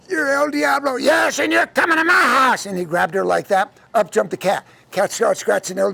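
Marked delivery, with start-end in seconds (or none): 0.1-0.9 high child-voiced accusation; little girl. Girl unmasking the devil, high-pitched. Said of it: "little girl says". 0.9-2.6 high, harsh roaring shout; El Diablo character. Acted. Devil seizing the girl, roaring.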